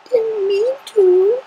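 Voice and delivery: very high, slow whimper. Acted. Fluttershy whimpering her apology to friends. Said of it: "Fluttershy whimpered".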